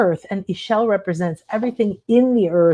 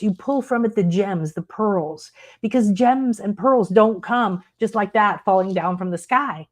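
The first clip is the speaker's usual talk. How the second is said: usual speaking voice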